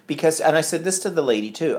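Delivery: ordinary delivery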